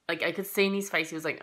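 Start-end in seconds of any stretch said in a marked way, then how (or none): none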